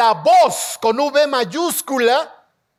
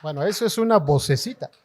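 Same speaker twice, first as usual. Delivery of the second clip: preacher teasing the crowd's weak answer, low and quick; teasing aside; 8 semitones lower, faster than usual. Real, not acted. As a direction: tease them under your breath.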